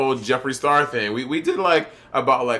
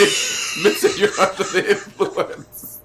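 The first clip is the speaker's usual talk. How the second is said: slow words through laughter; laughing; 3 semitones higher, much slower than usual. Real, not acted. Teasing Mitch as under the influence, laughing. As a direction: barely get it out while cracking up. Helpless laughter over the argument.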